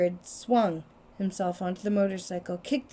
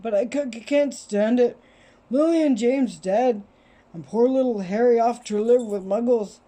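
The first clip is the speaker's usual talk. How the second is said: sobbing giant voice; 2 semitones higher, 5 dB louder than usual. Acted. Giant weeps over orphan.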